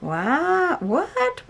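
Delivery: high, rising astonishment; amazed. Real, not acted. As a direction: let the pitch climb in wonder. Red suddenly appears in the paint.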